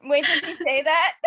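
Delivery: loud, laughing surprise; real giggly shock; delighted disbelief. Real, not acted. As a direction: burst out, can't believe he said it. Delighted shock at the other player's line.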